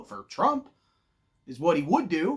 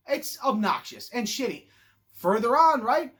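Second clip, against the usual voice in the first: ordinary delivery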